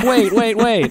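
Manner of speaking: high-pitched repeated interjection; mock urgent plea. Retelling the villain interrupting the cheers, mock urgent. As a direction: imitate someone trying to halt a cheering crowd.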